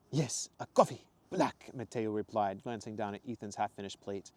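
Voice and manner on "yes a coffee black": higher Italian man's voice; emphatic Italian-accented reply. Acted. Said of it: "his faded Italian accent".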